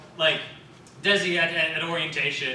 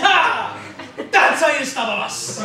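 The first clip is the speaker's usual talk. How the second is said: high, loud, laughing boast; triumphant hero; 8 semitones higher, 8 dB louder, slower than usual. Retold or imitated. The hero boasting after stopping the bus, loud and high. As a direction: crow it like a cartoon hero.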